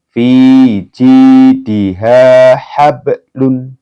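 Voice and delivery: slow loud recitation with bounced letters; Quranic recitation. Shown as a demonstration. Teacher reciting verse examples for students. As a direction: recite slowly in full Quranic style. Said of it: "Dan ini ya".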